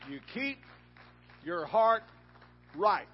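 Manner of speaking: high and loud, each word hammered; shouted. Real, not acted. Preacher to the church, shouting the refrain. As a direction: belt it out the second time.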